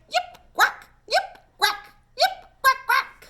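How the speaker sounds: very high alternating yips and quacks; dancing animals calling together; fox and duck calls. A narrator's reading. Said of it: "Duck turned up the music".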